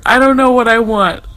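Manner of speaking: usual speaking voice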